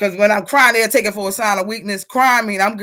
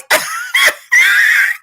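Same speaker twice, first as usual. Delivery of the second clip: very high, loud shriek of laughter; high screaming laugh; 5 dB louder than usual. Real, not acted. Howling at a comment.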